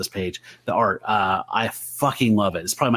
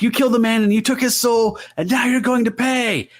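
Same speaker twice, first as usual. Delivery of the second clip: higher and louder, a mock villain shout; hammed-up threat; 12 semitones higher, 3 dB louder than usual. Reader acting Satana's brother threatening her, hammed-up shout. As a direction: bellow the threat like a comic-book devil. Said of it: "He's like".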